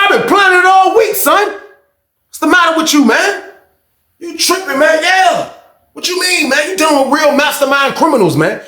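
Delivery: high-pitched, heated comeback; retold taunting. Inmate boasts to the man he has played, retold taunting. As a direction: crow over him in a high, insistent voice. Acted quarrel, taunting him about the scheme.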